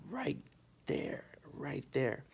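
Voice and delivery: whispering right there; hushed. Real, not acted. Hushed aside while pointing at the boulder. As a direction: hush it like pointing secretly.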